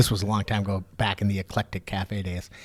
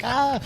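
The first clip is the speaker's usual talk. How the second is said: mock scream; 14 semitones higher than usual. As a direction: yelp as the plow nearly hits. Retold near miss with snowplow.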